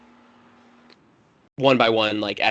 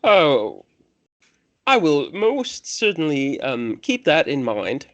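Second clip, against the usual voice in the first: slow, higher-pitched, hesitant courtesy; polite temple priest; 4 semitones higher, 3 dB louder, slower than usual. Acted. Priest responds to being told to step it up, slow and polite. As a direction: placate the visitor with measured words.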